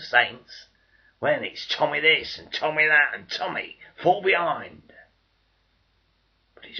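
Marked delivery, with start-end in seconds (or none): 1.7-4.9 much louder and higher, barking him back; loud contemptuous command; scornful order. Acted. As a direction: shout him to the rear. Civilians ordering him away.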